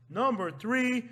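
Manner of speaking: loud, high countdown call; countdown announcer. Pretend. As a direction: announce the next entry big and high. Announces next entry in countdown.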